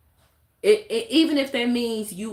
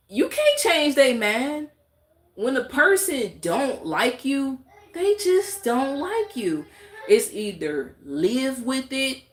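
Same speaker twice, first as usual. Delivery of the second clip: high, slow, drawn-out emphasis; slow high exasperated point; emphatic; 7 semitones higher, slower than usual. Real, not acted. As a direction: stretch each word up high.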